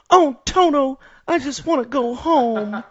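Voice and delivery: put-on high, loud, pleading little girl voice; Dorothy impression. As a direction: cry out like a homesick girl lost in Oz. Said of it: "it reminded me of".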